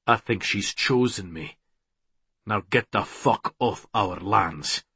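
low, soft, firm warning; quiet defiance. A narrator's reading. Man ordering villain off their lands, quietly firm. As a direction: tell him off without raising your voice.

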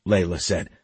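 plain reading voice

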